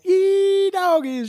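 high, loud Texan yee-haw; Sandy whooping on the bullworm, high and loud; cowgirl whoop. Retold or imitated. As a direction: whoop like a rodeo rider.

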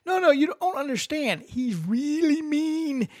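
high, slow pleading cartoon sponge voice; high, slow plea from SpongeBob to Sandy; squeaky SpongeBob. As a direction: beg her not to go in a high cartoon voice.

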